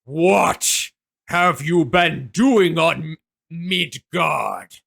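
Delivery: a hint of an echoing All-Father; Odin's voice. Odin questions the players from above, slow booming voice. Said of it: "it continues to sort of reverberate around and goes".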